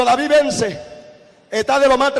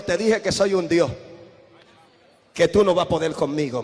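low and softer, a menacing voice from deep down; lightly voiced demon, low and soft; quiet demonic boast; 8 semitones lower, 5 dB quieter than usual. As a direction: let the demon gloat under its breath. The possessed woman boasting.

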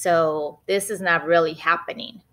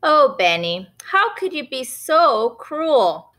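mock dismay; 7 semitones higher, 4 dB louder than usual. Mom reproaching naughty Benny, mock dismay. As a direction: plead with him, mildly appalled.

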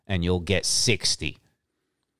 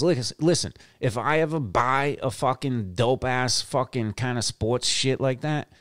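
ordinary delivery

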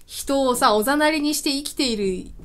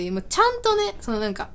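ordinary talk

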